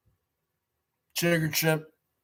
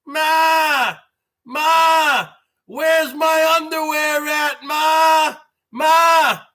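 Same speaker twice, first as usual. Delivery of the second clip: whiny yell for mom; 6 semitones higher, much slower than usual. Retold or imitated. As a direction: bawl for your mother like a helpless kid. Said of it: "I go".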